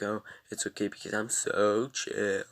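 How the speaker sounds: loud mocking chill voice; said really loud. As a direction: blurt it out loud in mock calm. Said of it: "I said that really loud".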